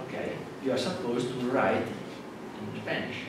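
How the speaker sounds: usual speaking voice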